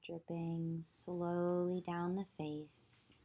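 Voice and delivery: ordinary delivery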